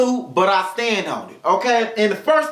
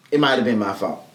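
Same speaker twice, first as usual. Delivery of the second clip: ordinary delivery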